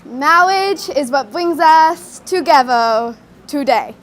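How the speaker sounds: "Marriage" as "mawwage", "brings" as "bwings", "together" as "togevah"; slow, pompous delivery with every r in "Marriage" and "brings" turned to w; mock speech impediment. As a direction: intone "Marriage" as "maw-widge", stretched and solemn. Parody of lisping wedding officiant.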